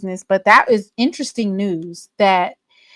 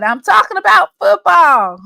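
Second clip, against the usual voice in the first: mock quote; 7 semitones higher, 7 dB louder than usual. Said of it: "when he said".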